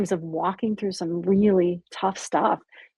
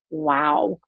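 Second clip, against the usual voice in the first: a much higher, amazed wow; stunned wow; 7 semitones higher than usual. Retold or imitated. Husband hearing her secret, retelling his amazed wow. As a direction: let the shock lift the word. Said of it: "he was like".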